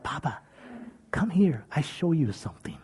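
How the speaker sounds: soft unvoiced child's whisper. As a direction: hush it so only Papa hears. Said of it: "come right over to me and just whisper".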